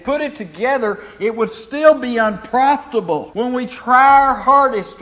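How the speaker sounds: emphatic sermon voice, swelling on key words; preaching. Real, not acted. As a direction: drive each point home to the congregation. Preaches to congregation.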